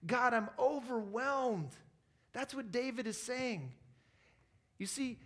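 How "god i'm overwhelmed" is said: slow, louder, higher cry to God; acting out being overwhelmed, drawn-out and loud; overwhelmed plea. Preacher voicing David's prayer to God, overwhelmed. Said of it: "you're just overwhelmed".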